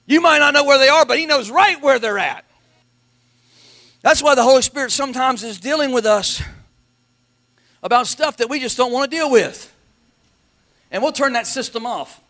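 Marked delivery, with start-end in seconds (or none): none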